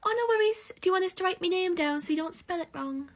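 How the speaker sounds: high, mock-helpful offer; sugar-coat the sarcasm; sarcastic sweetness. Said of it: "I go".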